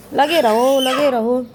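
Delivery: loud encouraging call; cheering on. Real, not acted. Cheering Deepa on as she cleans the courtyard, loud call. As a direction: shout it across to keep her going.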